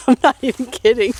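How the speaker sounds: real laughter through the words; laughing. Real, not acted. Laughing at mom's number habit.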